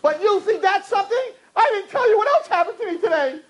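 very high, indignant rant; one-upping husband. Acted. Husband outdoing wife's bad day, high-pitched indignant. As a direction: top the other's woes in a hammed-up squeal.

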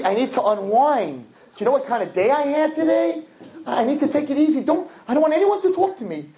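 indignant high-pitched protest; exasperated husband. Acted. Husband telling wife he needs to unwind, exasperated. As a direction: insist on being left alone, acted exasperation.

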